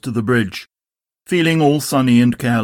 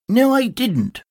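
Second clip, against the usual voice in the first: higher, quick denial; Tigger; 11 semitones higher, slower than usual. Acted. Tigger denies it to Christopher Robin, higher. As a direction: cut in like a bouncy tiger. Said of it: "said Tigger".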